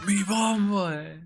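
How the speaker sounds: high, drawn-out mock Jamaican exclamation; imitated Jamaican accent. Streamer quoting a guy from a video, mock Jamaican accent. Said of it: "people from Jamaica often have that, that accent".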